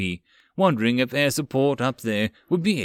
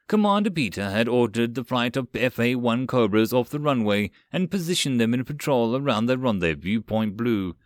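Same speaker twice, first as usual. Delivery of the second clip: usual speaking voice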